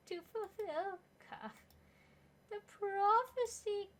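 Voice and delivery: high, slow, otherworldly prophet voice; ghostly prophet. Acted. Hallucinated spirit delivering a prophecy, slow ghostly voice. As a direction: intone it like a spirit in a vision.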